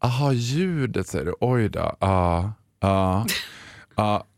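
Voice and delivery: slow, low, unbothered repair-shop clerk; slow tired tech guy. Retold or imitated. Mimicking the sluggish repair guy: slow, low. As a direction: drag it out like nothing matters. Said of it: "Utan han bara".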